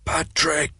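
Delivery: breathy, barely voiced name; faint whisper. A narrator's reading. Wounded Sharp telling Connolly his name, faint. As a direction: whisper it through the pain.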